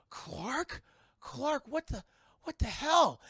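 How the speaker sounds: high, halting, building to loud; stunned disbelief. Retold or imitated. Kids spotting Clark behind the bus, acted astonishment.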